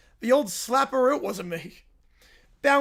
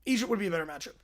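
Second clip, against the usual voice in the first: normal talking voice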